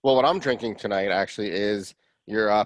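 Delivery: usual speaking voice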